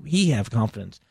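the frantic agent on the phone; agitated agent. Retold or imitated. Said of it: "He said".

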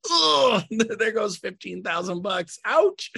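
high laughing wince; mock pained. Agent mourning a lost fee, mock pained. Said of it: "we're like".

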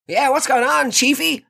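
high, eager bro greeting; trying too hard to sound like a lad; matey bro voice. Retold or imitated. Greeting the dish installer, overly matey.

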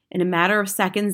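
ordinary delivery, nothing marked

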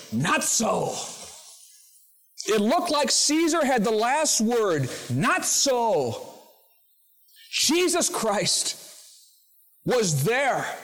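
preacher proclaiming Christ's rule to the congregation, full force; preaching at full force. Acted. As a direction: build it up like a revival climax. Preacher builds to climax.

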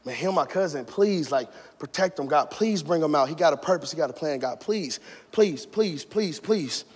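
low, hushed begging to God; soft, urgent repeated pleas; retold desperate prayer, kept very quiet; pleading prayer. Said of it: "begin to pray".

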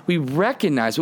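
ordinary delivery

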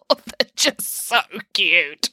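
very slow, drawn-out gushing; overcome by cuteness. Acted. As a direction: stretch each word, hammed-up and overcome. Boris gushes over baby ducks.

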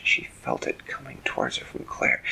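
usual speaking voice

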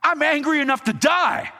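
high, hammed-up angry shout; furious outburst. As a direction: yell it back at God in a tantrum. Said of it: "he replied".

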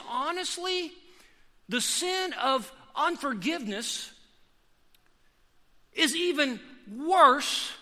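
high, loud, fervent preaching; preaching at full force. Acted. Pastor preaching to the church at full force. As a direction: drive it home from the pulpit.

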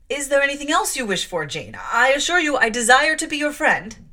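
put-on loud, rushed appeal to the girl; anxious pleading. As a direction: placate her nervously. Mrs Reed pleads, rattled.

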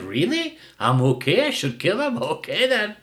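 mild mock dog voice, rising questions; dog's voice. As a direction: play the dog taking it as permission. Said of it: "the dog's like".

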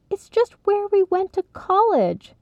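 high, defensive protest. Acted. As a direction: defend yourself, indignant. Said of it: "Sylvie defended".

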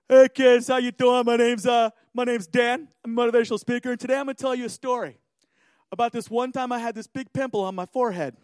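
lightly voiced chirpy youth speaker; motivational speaker. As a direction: open loud, like greeting an assembly. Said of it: "we had this motivational speaker".

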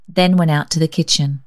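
ordinary delivery, nothing marked

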